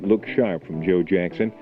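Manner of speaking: normal talking voice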